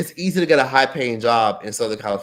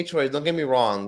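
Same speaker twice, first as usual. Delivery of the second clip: normal talking voice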